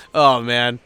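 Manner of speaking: normal talking voice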